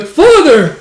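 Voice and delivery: put-on loud, very high boyish cry; excited shout. Small boy calling to his father, excited. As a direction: shout eagerly for dad.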